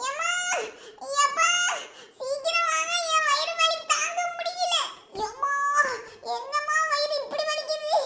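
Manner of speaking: high, whiny pretend pain wail; faked stomach ache. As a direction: moan and call for parents, putting on pain. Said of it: "அப்படியே நடிச்சிட வேண்டியதுதான்". (வயிறு வலி போல நடித்து அலறல்)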